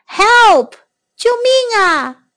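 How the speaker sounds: high, loud frightened call; scared cry for help. Shown as a demonstration. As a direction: shout help like you're scared. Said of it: "teacher repeats cry for help".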